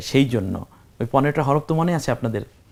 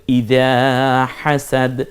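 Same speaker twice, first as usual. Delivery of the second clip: slow demo reading; much slower than usual. Shown as a demonstration. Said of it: "এখানে আমরা যদি থামি".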